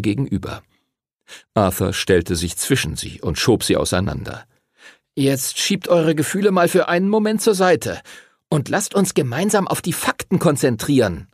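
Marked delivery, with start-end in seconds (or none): 5.1-11.3 high, insistent telling-off; snapping at them. A narrator's reading. Arthur separates the arguing friends, high insistent snapping. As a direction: order them both, voice pitched up. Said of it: "schnauzte er sie an".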